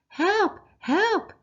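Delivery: high, loud panicked scream; high-pitched loud cry for help. Acted. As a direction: shriek it out like a terrified mum. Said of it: "screamed Mum".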